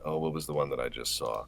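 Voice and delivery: ordinary talk, nothing marked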